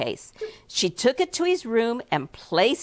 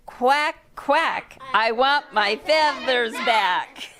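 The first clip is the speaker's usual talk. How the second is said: high, slow duck voice demanding; angry little duck; 4 semitones higher, slower than usual. Acted. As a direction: quack it out like a cross duckling. Mad duck demanding feathers.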